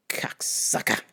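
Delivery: whispered parting insult. Retold or imitated. Saying goodbye to the coworker, whispering the insult. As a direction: breathe the insult out. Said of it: "I was like".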